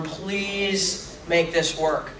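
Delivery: slow, soft, drawn-out plea; a hushed, pleading prayer; praying. Retold or imitated. Actor prays to every god before the take, pleading softly. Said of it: "I'm praying".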